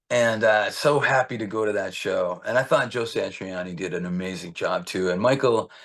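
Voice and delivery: ordinary delivery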